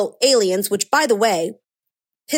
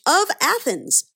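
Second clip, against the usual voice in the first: higher, pointed scare-quote on "of Athens"; mocking quote; 6 semitones higher, slower than usual. Commentator quoting CNN on the suspect, mocking. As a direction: hold "of Athens" up for ridicule. Sarcastically quoting CNN's wording.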